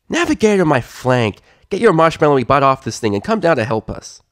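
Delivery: loud filly scolding. Acted. Scootaloo scolding Sweetie Belle, loud. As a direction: tell her off with a sneer.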